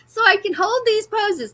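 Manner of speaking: giggling at her own line; laughing. Real, not acted. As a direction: crack up while repeating it.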